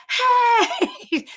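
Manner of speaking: high-pitched yelled "Hey!", breaking into laughter; retold scream. Robbed shopper calls after the thief, screaming. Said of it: "So I started screaming".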